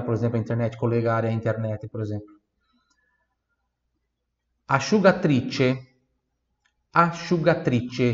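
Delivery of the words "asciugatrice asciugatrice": slow asciugatrice, sci as sh; slow model word. Shown as a demonstration. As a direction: draw out asciugatrice slowly. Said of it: "Repita em voz alta". (asciugatrice bem devagar)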